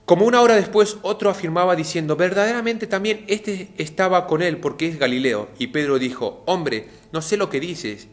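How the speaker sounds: preacher's dramatic scripture voice; dramatic reading. Acted. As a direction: proclaim the scene with rising fervour.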